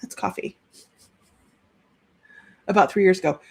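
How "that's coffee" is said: whispered to herself, voiceless. Real, not acted. Medium repeating the message to herself, in a whisper. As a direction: breathe it out under your breath.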